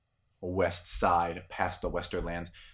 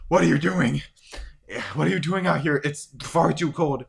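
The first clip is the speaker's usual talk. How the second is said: alarmed shout; 8 semitones higher, 8 dB louder than usual. Acted. Son alarmed at his father.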